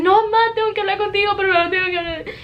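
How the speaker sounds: high, rushed, whiny insistence; mock tearful plea. Daughter insisting mom listen now, imitated tearful plea. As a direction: act out an upset kid pleading over mom, very fast. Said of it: "I was crying and I was like".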